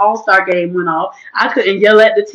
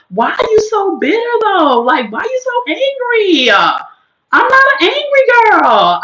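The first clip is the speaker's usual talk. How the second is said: incredulous; 11 semitones higher than usual. Real, not acted. Mocking mean commenters.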